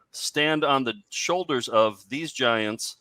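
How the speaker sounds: usual speaking voice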